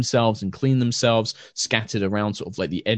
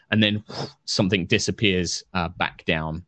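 ordinary talk, nothing marked

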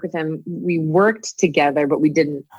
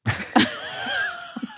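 giggly sinister sing-song; 6 dB quieter than usual. Retold or imitated. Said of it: "that sounded like a creepy clown".

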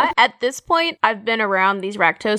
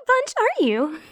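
cheery in-character call; 8 semitones higher than usual. Acted. Player greets cultists after ditty.

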